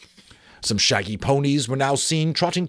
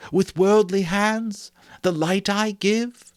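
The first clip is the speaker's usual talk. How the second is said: high, slow, wounded spirit voice; a gentle ghost chiding Scrooge; reproachful ghost; 9 semitones higher than usual. A narrator's reading.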